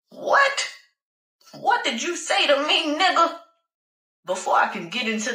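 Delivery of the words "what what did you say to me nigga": high, indignant confrontation; outraged challenge. Acted. Passenger confronting the cab driver who insulted her, high and outraged. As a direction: demand an answer, pitch leaping up in outrage.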